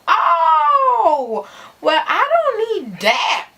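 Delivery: loud, high drawn-out "oh"; hammed-up loud recoil; mock reaction. Mock reaction to friend's confession.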